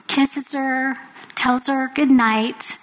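usual speaking voice

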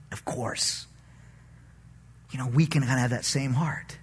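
ordinary talk

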